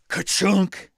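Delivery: punchy, loud mock "Ka-chunk!" like a stamp slamming down; bright onomatopoeic thud of a rubber stamp; stamp sound effect. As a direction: slam the "Ka-chunk!" out as the stamp hits. Stamping a dead character's sheet.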